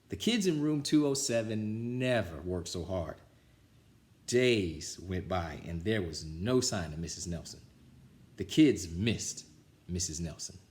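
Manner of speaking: usual speaking voice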